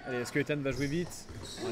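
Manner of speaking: ordinary talk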